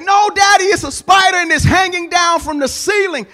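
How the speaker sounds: high, loud, frantic daughter; rising panic. Retold or imitated. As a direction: blurt it out as fear takes over. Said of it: "you can hear in her voice that she is starting to go into a panic".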